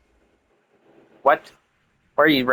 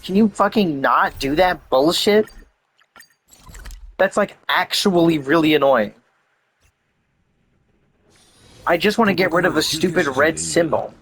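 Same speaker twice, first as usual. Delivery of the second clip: exasperated, higher-pitched griping; irritated, pitched-up rant; real annoyance; 4 semitones higher than usual. Real, not acted. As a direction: complain like you're truly fed up. Annoyed at interrupted play.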